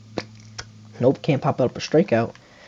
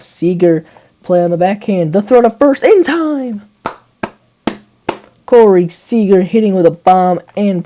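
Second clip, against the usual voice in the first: hyped play call; 6 semitones higher, 6 dB louder than usual. Real, not acted. Calls a defensive play.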